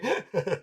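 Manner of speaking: high, quick giggle; brief self-amused chuckle; short laugh. Real, not acted. Laughing at own prayer story.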